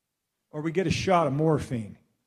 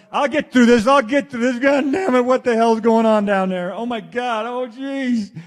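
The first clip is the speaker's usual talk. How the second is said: loud, higher frantic cries of pain; panicked yell; 5 semitones higher, 7 dB louder than usual. Retold or imitated. To the audience, acting out a man in agony, loud frantic yelling. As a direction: yell it like you're being tortured. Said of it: "not gonna sit there going".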